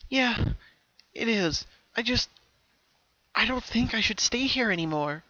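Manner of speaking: put-on a small girl unsure but decided; voiced as the younger self; young Pinkie voice. A narrator's reading. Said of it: "her younger self responded slowly".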